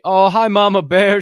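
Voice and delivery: loud, high delighted hello; light up greeting mom; thrilled to see mom in chat; excited greeting. Real, not acted.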